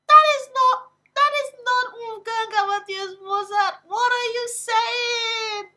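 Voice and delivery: high, loud indignant disbelief; outraged. Retold or imitated. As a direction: act scandalized over the composer. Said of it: "I was like".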